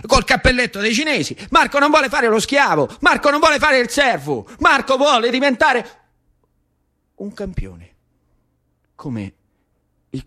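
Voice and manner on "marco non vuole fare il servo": ordinary talk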